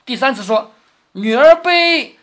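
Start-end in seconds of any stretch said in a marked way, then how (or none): 1.1-2.2 high, loud and hugely stretched syllables; drawn-out recitation. Acted. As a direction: proclaim it slowly, holding the last word high. 薛蟠第三次念酒令. (高声拖长腔念酒令)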